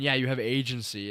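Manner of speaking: usual speaking voice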